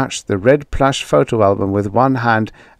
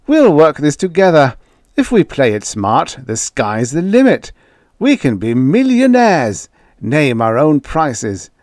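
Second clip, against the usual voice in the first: eager, louder pitch of a scheme; excited; 7 semitones higher, 5 dB louder than usual. A narrator's reading. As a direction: sell the dream with growing excitement. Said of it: "He laughed in his excitement".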